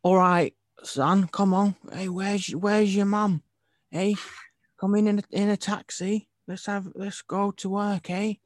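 high, loud exaggerated Cockney geezer accent; Cockney impression. Mocking the film's English extras with a Cockney accent. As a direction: ham up a London Cockney bloke. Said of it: "I thought they were just Cockney".

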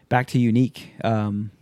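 usual speaking voice

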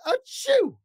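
big high cartoon achoo; loud sneeze. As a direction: sneeze it out hard. Porcupine sneezes.

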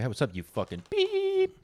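high, slow teasing hello; mock friendly greeting. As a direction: sing it out like a jokey insult. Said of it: "I'll walk in and say".

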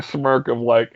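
normal talking voice

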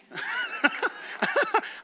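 high burst of laughter at his own joke; laughing at the haunting joke; amused laugh. Real, not acted. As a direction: let a real laugh break out. Joke about haunting new husband.

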